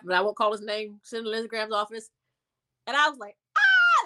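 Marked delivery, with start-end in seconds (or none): 3.6-4.0 high delighted shriek; excited scream. Retold or imitated. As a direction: let out a sudden thrilled scream. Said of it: "Like, I was screaming".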